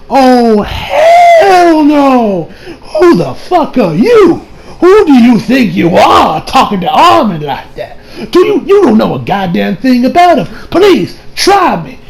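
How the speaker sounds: high, drawn-out mock outburst; acted indignant tirade, ready to fight; hammed-up outrage. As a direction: stretch the opening into a long high cry, then challenge him. Acts Aaron angrily confronting the man.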